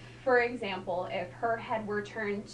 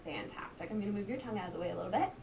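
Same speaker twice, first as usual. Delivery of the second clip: usual speaking voice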